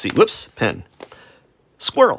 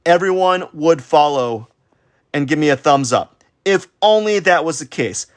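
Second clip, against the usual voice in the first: higher, louder dramatic voice; mock self-pity; 8 semitones higher, 4 dB louder than usual. Artist mocking own hopes of followers, hammed-up higher lament. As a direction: wail it like a melodramatic dreamer, pitched up. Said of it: "That's why I said to myself".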